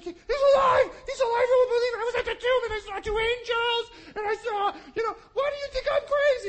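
high, loud, laughing near-tears shout; Mary bursting in, shouting with joy; ecstatic shouting. Retold or imitated. Mary shouting to the disciples that he's alive, high and ecstatic. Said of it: "she's like".

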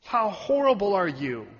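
usual speaking voice

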